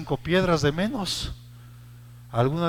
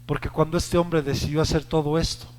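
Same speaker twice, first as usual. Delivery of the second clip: ordinary talk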